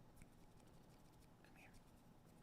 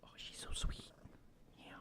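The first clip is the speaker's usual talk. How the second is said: hushed adoring whisper; 17 dB quieter than usual. Real, not acted. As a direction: whisper so you don't drown the purr. Whispering near purring cat.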